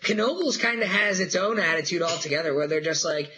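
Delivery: usual speaking voice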